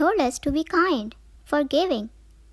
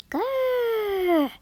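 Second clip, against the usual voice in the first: high, playful mock growl; cartoon growl of annoyance; 8 semitones higher than usual. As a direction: growl like a small grumpy grape. Grape growling at offenders.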